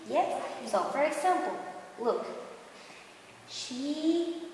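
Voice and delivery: usual speaking voice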